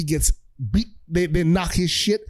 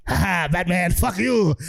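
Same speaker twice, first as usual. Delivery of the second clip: gloating Joker; slower than usual. Retold or imitated. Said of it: "Joker's like".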